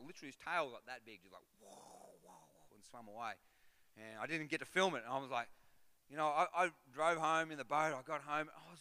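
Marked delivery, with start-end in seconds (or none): none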